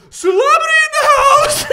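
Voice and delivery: all-out, very high announcing shriek; full-volume hammed-up fan holler; imitated scream. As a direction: scream it to a whole coffee shop. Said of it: "opens the door, uh, to Starbucks and screams".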